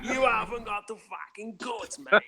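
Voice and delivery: high, badly faked London hard man; London gangster accent. Shown as a demonstration. Asked for a British accent, mock London gangster. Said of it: "all I know is from the gangster films".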